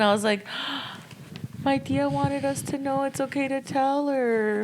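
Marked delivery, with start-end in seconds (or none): 0.5-1.6 gasp. Retold or imitated. Gasp at the sign. 1.6-4.6 awed realisation. Retold or imitated.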